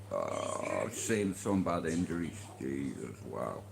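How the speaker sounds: low, soft, sobered aside; quietly stunned, voice sunk low; hushed awe. Real, not acted. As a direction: drop right down as you remember it. Recalling bad injuries in awe.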